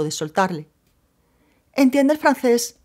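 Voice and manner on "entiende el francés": higher, louder surprised question, slow. A narrator's reading. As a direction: caught off guard, asks out loud. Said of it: "exclamó la mujer sorprendida". (pregunta sorprendida y aguda)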